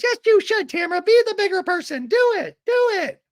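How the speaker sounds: very high, loud, hammed-up shout; mock emphatic urging. Recapper acts Emily egging Tamara on, mock shouted urging. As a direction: egg someone on in a squealing yell.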